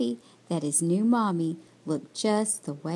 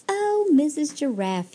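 higher-pitched call of a little bird; eager cry; 6 semitones higher, 3 dB louder than usual. A narrator's reading. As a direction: call out hopefully, higher than usual. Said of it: "he cried".

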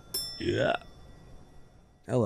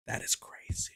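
softer, breathless; quiet; 17 dB quieter than usual. Real, not acted. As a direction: say it under your breath. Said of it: "repeated whisper".

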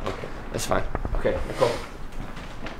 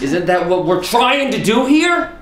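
exasperated shout; 11 semitones higher, 8 dB louder than usual. Real, not acted. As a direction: yell it in disbelief. Director exasperated at crew.

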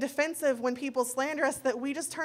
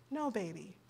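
tender reassurance; 4 semitones lower, 9 dB quieter than usual. Retold or imitated. Mother comforts worried daughter.

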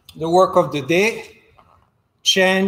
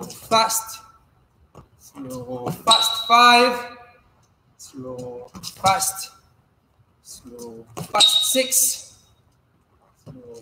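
calling the rhythm; 4 semitones higher, slower than usual. Shown as a demonstration. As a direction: coach calling the drill in time with the steps. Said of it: "coach calls footwork rhythm".

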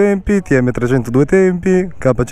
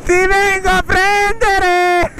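excited shout; much slower than usual. Real, not acted. Chasing friend in race.

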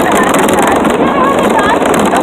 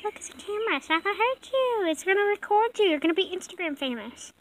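much softer reassuring voice to the frog; soft coaxing; gentle; 13 dB quieter, faster than usual. Real, not acted. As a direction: coax the frightened frog softly. Calming scared frog.

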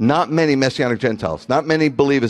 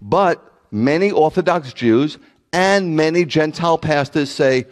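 ordinary talk, nothing marked

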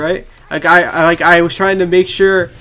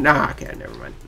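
usual speaking voice

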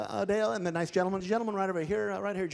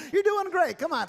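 ordinary talk

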